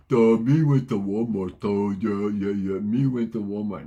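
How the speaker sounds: loud, dopey caveman voice; a dopey grunt of a voice; mock dumb guy. Mocking a dumb speaker.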